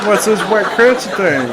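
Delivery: loud, high-pitched parroting; mimicked puzzled eater. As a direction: echo the comic's line, high and loud. Host echoes comedian's bit.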